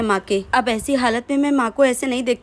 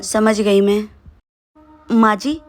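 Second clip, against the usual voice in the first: normal talking voice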